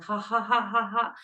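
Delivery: mock laughter, each ha spoken out; fake laugh. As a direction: run of forced ha-has. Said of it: "It's like, we laugh".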